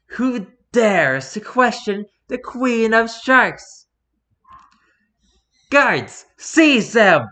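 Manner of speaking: slow, grand commanding queen voice, then a shout; a haughty shark queen ordering her guards; evil queen. Acted.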